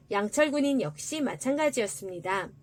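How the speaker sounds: ordinary delivery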